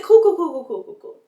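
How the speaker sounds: bright then fading resignation; cool cool, dying away; resigned. Retold or imitated. Reacting to ruined sandals.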